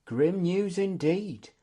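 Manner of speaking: slow, glum response. A narrator's reading. Guest reacts to bad news at council, glumly. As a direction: drag it out gloomily. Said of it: "said Algoldion glumly".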